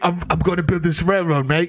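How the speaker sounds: mock British accent. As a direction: play a London bloke in a put-on British accent. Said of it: "He's like".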